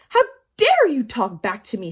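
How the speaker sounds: loud outraged snap; indignant teacher bark; snapped. A narrator's reading. Teacher snapping at Todd for answering. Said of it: "snapped Missus Jules".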